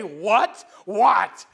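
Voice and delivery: loud, short mock interruption; disrespectful what. Subordinate cutting off his superior, loud mock disrespect. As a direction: cut in on a superior, mockingly loud. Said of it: "like, no big deal".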